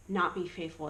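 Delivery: normal talking voice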